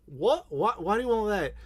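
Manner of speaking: British attempt drifting Australian, puzzled; badly faked Australian-sounding British accent; botched British accent. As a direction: ask in a wobbly mock British-Australian accent. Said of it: "that's more of an Australian".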